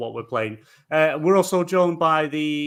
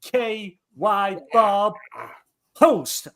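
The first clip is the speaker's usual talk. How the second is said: announcer voice; 6 semitones higher, much slower than usual. Pretend. Host intro announcer voice.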